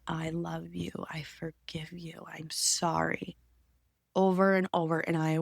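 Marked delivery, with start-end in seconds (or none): 0.1-0.9 soft unvoiced whisper; a tender whisper to herself. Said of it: "I began to whisper". 1.1-2.2 very soft unvoiced whisper; forgiving herself in a whisper. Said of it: "I began to whisper". 2.3-3.4 slow unvoiced whisper; apologizing to herself in a whisper. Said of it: "I began to whisper".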